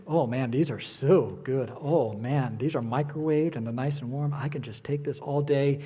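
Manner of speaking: low, mildly blissful savoring; mock relish, as if mid-treat. Acting out savoring warm donuts.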